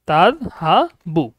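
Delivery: তাযহাবু drawn out slowly, syllable by syllable; slow model word. Shown as a demonstration. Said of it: "এই তাযহাবু শব্দটা আবার দুইটা হয়". (তাযহাবু ধীরে ধীরে উচ্চারণ)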